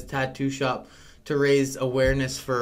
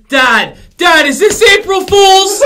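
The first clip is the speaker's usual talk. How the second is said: high, loud and slow, calling out the trick; suspicious kid shout; 15 dB louder, slower than usual. Retold or imitated. Daughter calling out dad's fake injury, high slow shout. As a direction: drag it out, calling dad's bluff.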